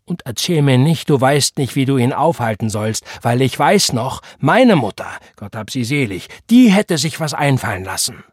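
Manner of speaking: loud, emphatic accusation; angry reproach. A narrator's reading. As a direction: hammer home the blame. Vater macht Mutter Vorwürfe. (wütend vorwurfsvoll)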